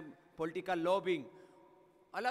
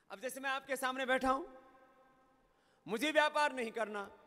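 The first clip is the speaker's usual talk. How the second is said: higher and louder, pressing each मुझे ... नहीं चाहिए; fervent preaching; 6 semitones higher, 4 dB louder than usual. Acted. Preaching, rising to full force. (ऊँची, ज़ोरदार प्रवचन की आवाज़)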